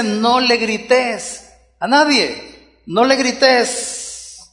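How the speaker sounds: very slow, deliberate commandment; slow, weighty divine warning; slow stern command. Acted. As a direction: spell it out like a father's rule. Preacher voicing God's command.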